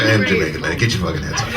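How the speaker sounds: low Jamaican accent, deep mock. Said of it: "He's like".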